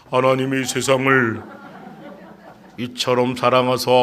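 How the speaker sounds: put-on low, level teenage recitation. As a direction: recite like a boy putting on a deep voice. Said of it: "목소리 깔게 되죠".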